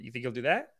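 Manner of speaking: normal talking voice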